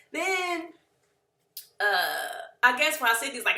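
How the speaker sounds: ordinary talk, nothing marked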